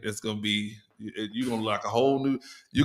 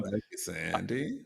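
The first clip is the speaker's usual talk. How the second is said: quiet, drawn-out thank-you; a soft mock-tender thanks; soft slow thanks; 5 semitones lower, 12 dB quieter, slower than usual. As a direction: gently thank the listener. Echoing thanks to listener.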